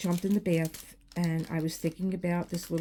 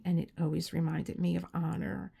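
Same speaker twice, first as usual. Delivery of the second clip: ordinary delivery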